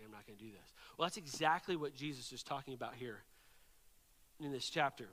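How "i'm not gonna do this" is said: the hired soldier quietly deciding it isn't worth it; muttered refusal. Retold or imitated. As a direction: lower and much softer, a deadpan muttered opt-out. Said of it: "he was like".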